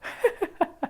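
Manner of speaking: a quick quiet laugh slips out; a real little laugh at her own joke; short soft laugh. Real, not acted. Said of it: "So I'm laughing because".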